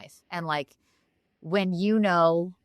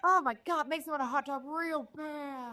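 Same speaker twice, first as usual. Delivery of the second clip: much higher and much softer, a dreamy mock Coolidge; soft high Jennifer Coolidge voice; Jennifer Coolidge impression; 7 semitones higher, 7 dB quieter than usual. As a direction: croon it like a lazy Hollywood diva. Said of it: "your Jennifer Coolidge is so good".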